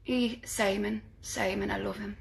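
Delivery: soft Geordie impression; quiet, light Geordie accent. Shown as a demonstration. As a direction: do a hushed Geordie pop-star impression. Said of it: "I can just do a [name] impression".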